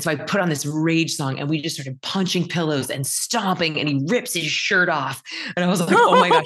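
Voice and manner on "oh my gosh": high, loud laughing exclamation; squealed laughing surprise; laughing oh my gosh. Retold or imitated. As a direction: burst out in delighted shock. Mom reacts to son ripping shirt.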